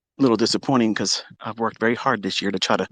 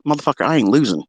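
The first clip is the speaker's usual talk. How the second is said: normal talking voice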